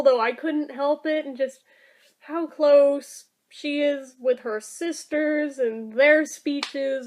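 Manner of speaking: ordinary delivery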